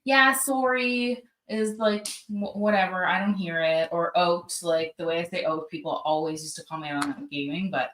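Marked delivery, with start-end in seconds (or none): none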